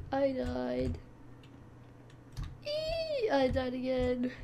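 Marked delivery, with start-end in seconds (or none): 2.6-3.3 high squeal. Real, not acted. Squeals as characters die.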